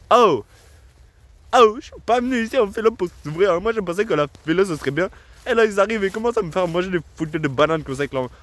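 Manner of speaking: indignant exaggerated African accent, fast toward the end. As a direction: blow up about philosophy class in a caricatured African accent. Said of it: "une imitation de stéréotypes".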